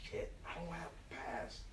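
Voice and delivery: hushed, barely voiced dismay; under-breath panic. Caught without a train pass, a hushed panicked curse. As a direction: mutter it to yourself at the turnstile. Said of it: "and then I'm like".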